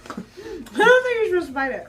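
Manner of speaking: laughing while repeating the line. Real, not acted. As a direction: giggle through the words. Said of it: "repeated laughing".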